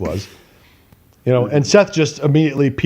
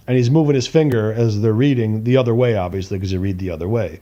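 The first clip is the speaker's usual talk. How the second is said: plain reading voice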